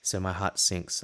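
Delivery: usual speaking voice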